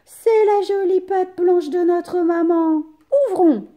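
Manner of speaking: put-on high, loud happy kids; little goats cheering in relief; relieved kids. A narrator's reading. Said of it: "disent les chevreaux rassurés".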